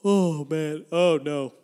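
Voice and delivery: yawning. Real, not acted. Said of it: "Once you start yawning".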